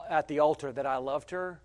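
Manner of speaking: ordinary talk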